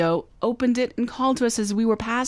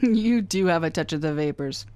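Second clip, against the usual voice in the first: teasing laugh; 4 semitones lower than usual. Acted. Teasing friend after his claim.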